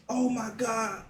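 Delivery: ordinary delivery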